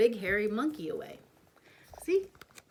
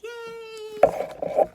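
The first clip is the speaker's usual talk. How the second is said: much higher loud cheer; delighted princess; happy; 13 semitones higher, 5 dB louder than usual. Acted. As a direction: cheer with joy. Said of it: "She's happy".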